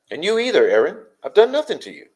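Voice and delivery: high-pitched, indignant protest; exasperated protest. Real, not acted. Streamer telling a chatter to leave them alone, exasperated protest. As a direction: plead innocence, pitch jumping up.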